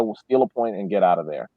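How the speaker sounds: ordinary delivery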